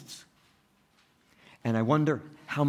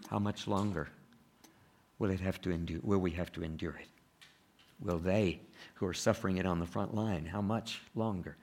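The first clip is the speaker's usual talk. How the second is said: soft lament; 5 semitones lower, 6 dB quieter than usual. Real, not acted. Preacher drops to soft lament.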